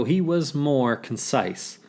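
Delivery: plain reading voice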